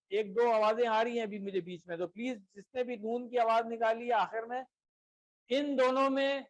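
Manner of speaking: usual speaking voice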